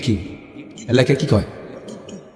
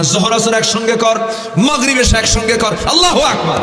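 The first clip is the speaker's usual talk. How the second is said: rising fervor; 6 semitones higher than usual. Real, not acted. Preaching climax.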